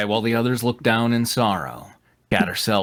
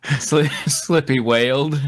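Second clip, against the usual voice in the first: laughing wail; 5 semitones higher, 3 dB louder than usual. Acted. Narration read in wail.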